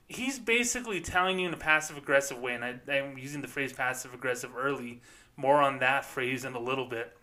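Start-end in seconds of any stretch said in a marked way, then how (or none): none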